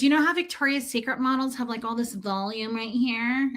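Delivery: a light put-on salesgirl voice, quick; lightly voiced bra fitter explaining; mild mimic of the fitter; imitating the shop girl. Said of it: "that's what she sounded like".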